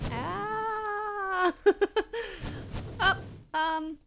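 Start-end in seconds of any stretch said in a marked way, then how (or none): 0.1-1.5 sharp high startled cry; startled yelp. Real, not acted. Mob appears suddenly. 1.6-2.9 high laugh after a scare; nervous laugh. Real, not acted.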